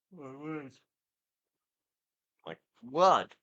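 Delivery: words stretched through a real yawn. Real, not acted. Podcaster yawning mid-show, talking through a yawn. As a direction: let the yawn swallow the words. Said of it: "I'm yawning a lot".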